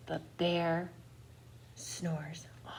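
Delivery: soft breathy hush, barely voiced; whispered bedtime refrain. A narrator's reading. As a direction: whisper it so the bear stays asleep. Sleeping bear refrain in story.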